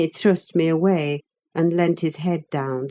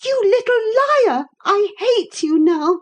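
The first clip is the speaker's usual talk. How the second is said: put-on high, loud girlish outburst; shrieky young lady losing her temper; passionate fury; 4 dB louder than usual. A narrator's reading. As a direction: fling it out hot with anger. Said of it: "she panted, and her face grew red with passion".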